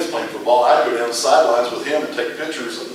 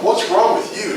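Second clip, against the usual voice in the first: higher, puzzled what's wrong; startled question; 9 semitones higher, slower than usual. Retold or imitated. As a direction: ask it half alarmed. Reacting to friend's hyper state.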